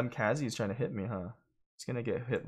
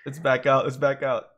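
excited; 3 semitones higher, 8 dB louder than usual. Real, not acted. Excited callout.

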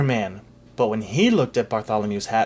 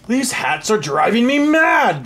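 high, loud, slow outburst; exasperated shout; 9 semitones higher, 6 dB louder than usual. Acted. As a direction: rage it through the palace. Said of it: "the king's voice rang out".

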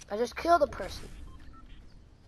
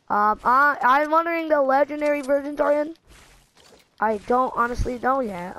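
ordinary delivery

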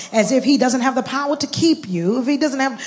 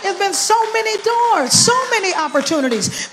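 high, raised preaching cry with SO leaned on; emphatic repeat; 8 semitones higher, 4 dB louder than usual. Real, not acted. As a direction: cry it out to the congregation, pitched high.

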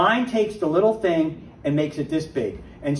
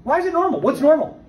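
high, loud, incredulous questions; indignant challenge; 12 semitones higher, 4 dB louder than usual. Real, not acted. Preacher to the congregation, rejecting relapse as normal, loud and high. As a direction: fire back at the idea that relapse is normal.

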